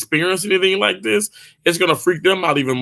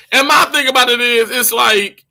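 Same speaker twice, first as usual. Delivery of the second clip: ordinary delivery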